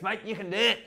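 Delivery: broad Australian pep talk; big Australian man. Acted. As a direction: urge a mate on in broad Aussie, stretching the last words. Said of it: "And he goes".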